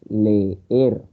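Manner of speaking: "Leer" slowly split as le-er, each e its own syllable; two separate vowels. Shown as a demonstration. Teacher showing vowels that separate in hiatus, syllable-split demo. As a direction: say "Leer" with both e sounds heard apart. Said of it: "en dos sonidos diferentes".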